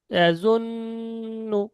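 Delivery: ইয়াজুন্নু with a long hummed n, held high; slow ইয়াজুন্নু, the doubled n drawn out nasally; nasal ghunna. Shown as a demonstration. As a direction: hold the ন in ইয়াজুন্নু in the nose, then release to nu. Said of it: "গুণনাটা, তখন হবে".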